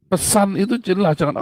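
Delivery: ordinary talk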